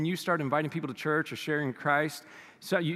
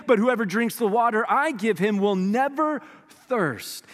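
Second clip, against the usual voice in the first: loud, high preaching read; scripture reading; 5 semitones higher, 5 dB louder than usual. Acted. Preacher reading John four aloud, proclaiming. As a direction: proclaim the Bible passage to the congregation.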